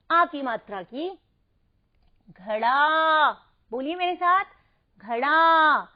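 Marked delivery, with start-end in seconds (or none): 2.3-3.3 घड़ा with the final आ drawn out and loud; long final आ. Shown as a demonstration. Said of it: "आ की आवाज आ रही है". 5.0-5.9 घड़ा with a loud, held final आ; long final आ. Shown as a demonstration. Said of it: "आ की आवाज आ रही है".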